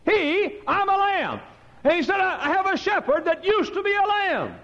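high, ringing pulpit cry; preacher proclaims God's words; full-force preaching. Acted. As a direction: lift it to the rafters.

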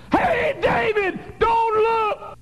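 very high, loud shout of warning; retold scream. Preacher reliving shouting a warning at David in the Bible, very high and loud. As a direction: yell at David to stop him. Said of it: "I screamed".

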